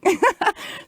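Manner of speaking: a high delighted burst of laughter; real laugh. Real, not acted. After telling Sherry to sing at the party, a delighted laugh. Laughs at her own prescription.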